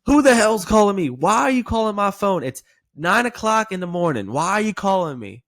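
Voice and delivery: high, loud irritated shout; angry prospect. Acted. Woken caller snapping at the agent, loud and angry. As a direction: bark it out like a rudely woken caller. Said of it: "the playful tone that I use most often is going to be in the intro".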